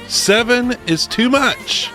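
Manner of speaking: ordinary talk, nothing marked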